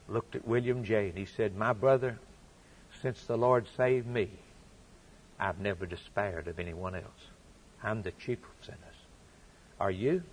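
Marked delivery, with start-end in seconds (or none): 1.5-10.2 low, soft confession; humble reply. Retold or imitated. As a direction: gently confide it to a brother. Newton's humble reply, retold.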